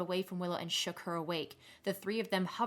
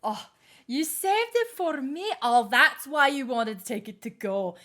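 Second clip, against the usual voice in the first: put-on delighted drunk Grena; 4 semitones higher, 5 dB louder than usual.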